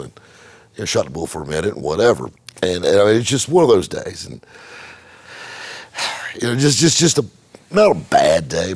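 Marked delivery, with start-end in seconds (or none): 5.3-6.3 long breathy sigh of defeat; drained exhale; weary sigh. Real, not acted. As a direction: let the whole day out in one sigh. Frustrated bad day.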